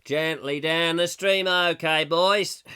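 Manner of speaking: a Roman rowing, done badly in Australian; lightly sung row your boat in weak Australian accent. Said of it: "I'm not very good on an Australian accent".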